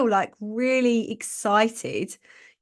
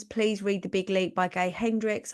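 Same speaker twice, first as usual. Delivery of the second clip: usual speaking voice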